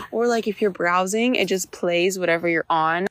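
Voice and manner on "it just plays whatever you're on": ordinary talk, nothing marked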